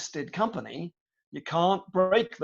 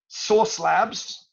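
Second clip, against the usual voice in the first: American accent; 5 semitones higher, 4 dB louder than usual. Shown as a demonstration. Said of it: "I have to say it in an American accent".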